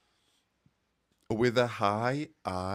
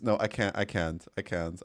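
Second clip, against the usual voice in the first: normal talking voice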